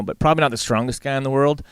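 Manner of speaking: usual speaking voice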